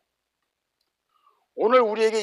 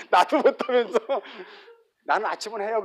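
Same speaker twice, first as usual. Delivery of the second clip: ordinary delivery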